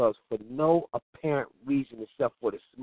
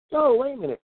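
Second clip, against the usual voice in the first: mock disbelief; 12 semitones higher than usual. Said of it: "You're like".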